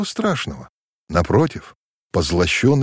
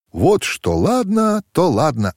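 high, slow, gladly agreeing; delighted; 8 semitones higher, slower than usual. A narrator's reading. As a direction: greet the idea with joy. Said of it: "обрадовалась Танюша". (радостно, высоко и протяжно)

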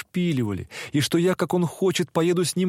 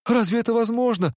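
higher, startled hope; sudden hope; 8 semitones higher than usual. A narrator's reading. Nastenka lifts her head in sudden hopeful surprise. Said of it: "вдруг подняв голову".

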